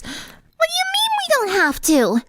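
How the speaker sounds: very high, shouted disbelief; bubbly girl outraged; indignant squeaky shout. Acted. Pinkie shouts at Sunset in very high disbelief. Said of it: "Pinkie asked".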